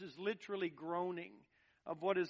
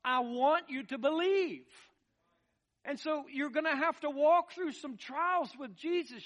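raised, higher-pitched preacher's delivery; impassioned preaching; 6 semitones higher, 5 dB louder than usual. Real, not acted. Preacher urging believers through trials with Jesus, impassioned preaching. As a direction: preach it out with mounting conviction. Preaches at full force to congregation.

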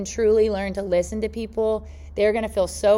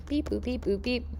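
quiet sung-out keypad beeps; imitated dialing beeps; 3 semitones higher, 10 dB quieter than usual. Speaker mimes calling the airline, imitated dialing beeps. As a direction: beep and boop like phone keys.

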